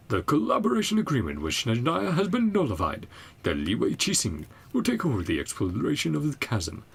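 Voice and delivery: loud, high-pitched notice reading; official proclamation. Acted. Game player reading an official notice aloud, proclamation voice. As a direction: declaim it like a town crier.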